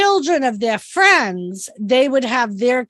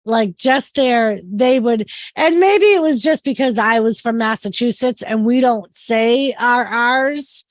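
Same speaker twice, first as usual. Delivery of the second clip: usual speaking voice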